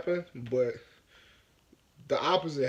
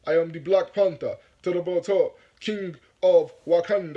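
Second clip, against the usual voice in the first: louder, deliberately unconvincing African accent; put-on African accent; 3 semitones higher, 6 dB louder than usual. Shown as a demonstration. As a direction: proclaim it in a fake African accent, slowing at the end. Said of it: "try to talk like I'm from Africa".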